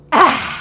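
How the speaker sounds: loud tongue-out ah; lion's breath. Shown as a demonstration. Teacher leading the class's lion's breath, loud tongue-out ah. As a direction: roar the breath out like a lion. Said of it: "stick out your tongue".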